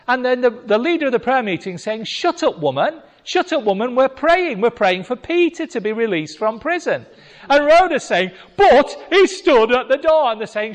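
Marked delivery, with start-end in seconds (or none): 2.0-7.0 higher, louder irritated hush; mock-exasperated prayer leader; annoyed shushing. Leader hushing Rhoda in prayer. 8.5-10.3 higher, louder urgent plea; insistent girl. Retold or imitated. Rhoda insisting to the leaders.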